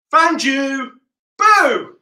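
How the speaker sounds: loud high found you and boo; playful shout. Pretend. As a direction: pounce on the kids with a shout. Seeker catches kids.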